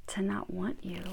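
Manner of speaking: soft breathy whisper. Real, not acted. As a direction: drop to a whisper. Whispered aside about wanting.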